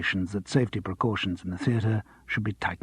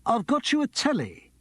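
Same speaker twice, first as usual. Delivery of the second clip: higher, proud grand announcement; grand agent; 11 semitones higher than usual. Acted. Agent telling Charles of a job, proud and grand. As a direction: announce it like a triumph.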